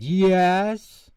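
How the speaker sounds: high, loud insistence from the cashier; insistent shout. Acted. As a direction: shout him down. Argument at cash register.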